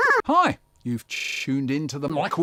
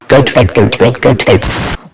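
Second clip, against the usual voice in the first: louder fed-up nagging; 2 semitones lower, 11 dB louder than usual. Retold or imitated. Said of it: "he looks at us all fed up and he says".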